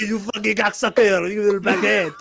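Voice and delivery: hammed-up French accent; over-the-top French sneer. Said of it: "decided to make what's his face ... French".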